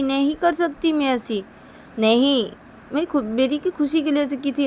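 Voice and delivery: usual speaking voice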